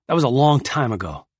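usual speaking voice

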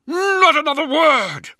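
very high, drawn-out furious roar. A narrator's reading. Librarian silencing Luther, roaring in fury. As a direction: bellow to silence him. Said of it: "Israfel roared".

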